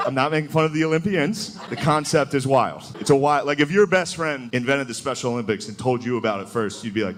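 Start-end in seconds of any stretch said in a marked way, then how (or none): none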